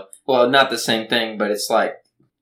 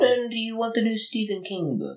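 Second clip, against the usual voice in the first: shopkeeper voice; 9 semitones higher, 4 dB quieter than usual. Acted. Co-host voices Davis selling.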